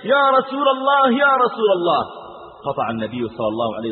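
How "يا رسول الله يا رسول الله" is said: urgent call. Acted. Man in the mosque calling the Prophet, urgent raised cry. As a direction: call out to interrupt the sermon. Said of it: "أخذ يصيح".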